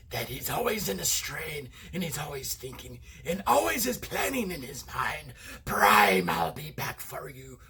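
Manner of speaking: strained maniacal villain voice; scheming robot tyrant building to a threat; Megatron. Shown as a demonstration. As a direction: strain it out, always plotting. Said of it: "that maniacal Megatron kind of voice".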